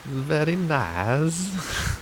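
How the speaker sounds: high, slow Borat impersonation. Retold or imitated. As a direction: stretch it out slowly like Borat. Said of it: "Borat in it".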